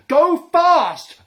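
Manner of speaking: high, loud frantic shout. A narrator's reading. Maskelyne shouting to the teams, high and loud. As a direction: bellow it over the engine. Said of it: "Maskelyne shouted".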